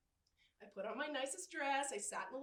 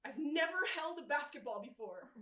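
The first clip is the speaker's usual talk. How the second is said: usual speaking voice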